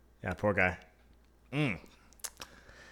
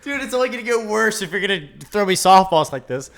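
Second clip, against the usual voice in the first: usual speaking voice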